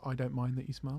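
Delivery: leaning in, softly, stifling a laugh; quiet mock confiding to a stranger; soft half-whisper. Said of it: "I'll just lean in and whisper and be like".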